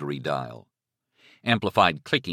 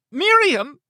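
very high, loud shriek; 8 dB louder than usual. Acted. Captive gives her name under threat, shrieking. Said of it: "she shrieked".

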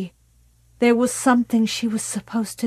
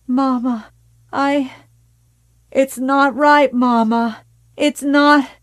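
higher and louder, begging in distress; desperate pleading; 5 semitones higher, 6 dB louder than usual. Acted. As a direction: cry out against her mother's knife. Carrie pleading with her mother.

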